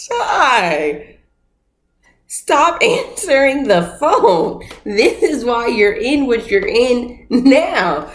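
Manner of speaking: high, slow, drawn-out reproach; exasperated scolding. Real, not acted. Fed-up viewer scolding Ty for taking calls, exasperated. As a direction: stretch each word out in exasperation.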